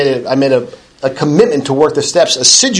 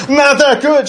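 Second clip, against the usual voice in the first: higher-pitched glum reply; 10 semitones higher, slower than usual. Retold or imitated. Woman's reply, second meeting.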